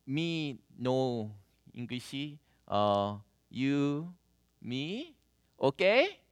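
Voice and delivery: put-on halting, broken Korean-accented English, one word at a time. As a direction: play a grandma with barely any English, words dropped in pieces. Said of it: "how we understand broken English".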